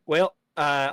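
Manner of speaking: normal talking voice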